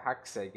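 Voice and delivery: Hackseg said as "hak-seg", low and soft; English pronunciation. Shown as a demonstration. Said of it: "você precisaria falar hackseg".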